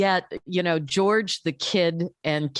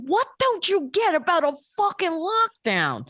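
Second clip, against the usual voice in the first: put-on high exasperated kid voice; indignant little kid; 11 semitones higher than usual. Kid scolding his mom about lockdown, exasperated high voice. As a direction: mimic a fed-up little boy. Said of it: "And he goes".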